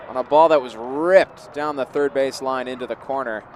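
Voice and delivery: loud punch on the hit, then easing off; excited play-by-play. Real, not acted. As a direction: call the replay with energy, settling down. Recapping the hard hit.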